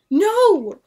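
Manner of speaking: high loud scream. Acted. William seeing the stakes, horrified scream. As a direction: scream in horror. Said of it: "William screamed".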